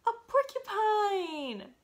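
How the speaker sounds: slow, high delighted reveal; a big storybook gasp of discovery; surprised reveal. Acted. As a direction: announce the find with wide-eyed glee. Lift-the-flap reveal to child.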